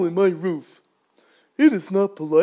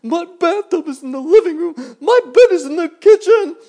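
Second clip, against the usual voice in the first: very high, loud frantic cries; worm panicking; 21 dB louder than usual. Acted. Rolling worm calls to grasshopper, loud high panic. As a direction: yell for help as you roll away.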